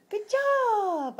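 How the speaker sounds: high, loud, stretched-out cheer; excited praise. Real, not acted. Praising toddler for arms up.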